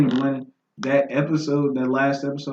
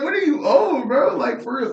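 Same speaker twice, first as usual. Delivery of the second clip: very high-pitched baffled protest; mock incredulity; 13 semitones higher than usual. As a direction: imitate someone floored, voice pitched way up. Acting stunned reaction from film.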